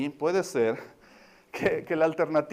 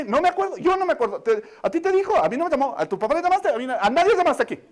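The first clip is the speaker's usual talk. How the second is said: higher and louder denial; indignant mother; 7 semitones higher, 5 dB louder than usual. Retold or imitated. Mother insisting nobody called her, indignant and loud. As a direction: complain like a wounded mom.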